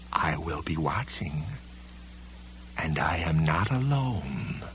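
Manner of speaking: slow, very soft threat sinking into a whisper; eerie, drawn-out hushed warning; menacing whisper. A narrator's reading. Library policeman haunting Sam's memory, slow whispered menace.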